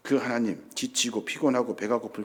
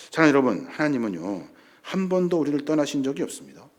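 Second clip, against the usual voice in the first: ordinary talk, nothing marked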